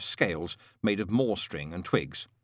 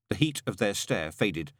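ordinary talk

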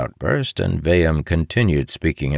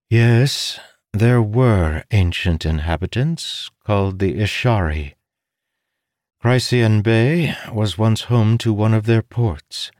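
slightly raised, measured briefing voice; a hint of a learned woman explaining; lightly voiced scholar; 7 semitones higher, 3 dB louder than usual. A narrator's reading. Bayham continuing briefing.

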